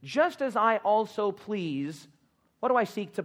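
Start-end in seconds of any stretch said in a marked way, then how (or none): 0.0-2.1 Just as I also please, very slow with just stressed; slow, drawn-out cue. Shown as a demonstration. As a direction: draw it out for the congregation to finish. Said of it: "you didn't say that with enough enthusiasm".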